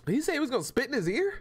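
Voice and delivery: high, disbelieving laugh; shocked laugh. Real, not acted. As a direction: react in amused disbelief.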